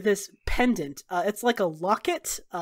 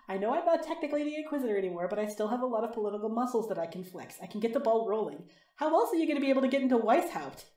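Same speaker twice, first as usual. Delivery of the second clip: softer, quick-paced voice over the crystal; confident, persuasive former Inquisitor; 2 semitones higher, 5 dB quieter, much faster than usual. Acted. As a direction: make the case briskly without raising the voice.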